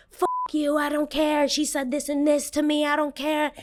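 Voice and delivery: high-pitched mock defiance; defiant kid. Kid brushing off the parents after the attack, high mock defiance.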